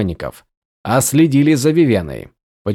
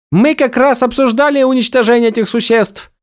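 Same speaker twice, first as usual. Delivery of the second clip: outraged cry; 11 semitones higher than usual. A narrator's reading. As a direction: shout in shocked indignation. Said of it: "вскричала Вивена". (возмущённый громкий возглас)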